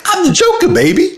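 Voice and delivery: squeaky falsetto mimicry; hammed-up high-pitched silly Joker; imitating clip's Joker guy. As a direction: squeal it very high, half laughing. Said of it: "large guy who has the Joker, like, face paint".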